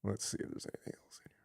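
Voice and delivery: whispered, quick aside to self; muttering to self. Real, not acted. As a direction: whisper it under your breath while searching. Reading to self while searching.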